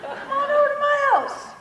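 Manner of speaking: high, sing-song dare; taunting invitation. Acted. Daring the people who sent death threats, in a high taunting voice.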